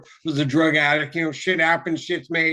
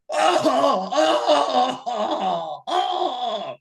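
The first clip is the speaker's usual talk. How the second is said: mock groans of pain; 8 semitones higher, much slower than usual. Gym-goer straining on a foam roller, mock pained groans. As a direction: groan like stretching hurts terribly.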